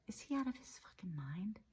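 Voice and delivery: hushed, breathy disbelieving whisper; shocked whisper. Real, not acted. Recoiling from the drunk German's Holocaust denial, stunned whisper. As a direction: whisper it in horror.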